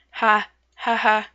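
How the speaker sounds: small hesitant ha ha ha; quiet forced laugh. Acted. First shy attempt at laughing at the sea, timid ha ha. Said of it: "You're getting there".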